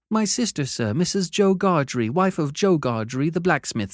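put-on high, frightened boy; timid child. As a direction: explain timidly.

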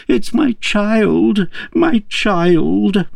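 high, drawn-out wail; acted sobbing. A narrator's reading. Weeping Queen laments her child to the King, sobbing. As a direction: lament slowly in a high tearful voice. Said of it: "sobbed Her Majesty".

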